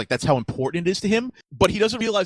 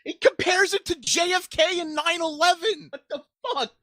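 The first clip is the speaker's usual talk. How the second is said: shocked disbelief; 10 semitones higher than usual. Real, not acted. Shocked reading from the book.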